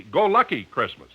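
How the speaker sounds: usual speaking voice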